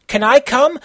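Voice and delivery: high, loud eager ask; hyper. Acted. Konata begging Kenshiro to come along, high and hyper. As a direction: bounce it out, hyper. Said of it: "Konata skid hyper".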